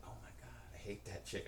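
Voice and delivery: soft, disgusted mutter. Retold or imitated. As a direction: mutter it under your breath.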